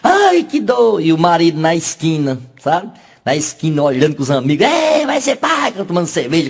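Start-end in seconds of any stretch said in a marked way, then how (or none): 0.0-1.0 slow, high cries of labor pain; the woman in labor cries out, drawn out; hammed-up birthing yell; mock screaming. 4.7-5.7 slow, high cheer; the husband toasts with his friends; hammed-up celebration.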